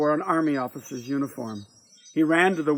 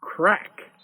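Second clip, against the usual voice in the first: sudden higher, sharp crack; rifle shot; 4 semitones higher than usual. A narrator's reading. Narrator voicing the rifle shot, sudden sharp word. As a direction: voice the word like a gunshot. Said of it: "Wright's representation of a rifle shot".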